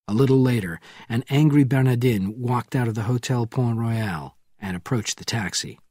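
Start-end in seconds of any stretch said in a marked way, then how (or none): none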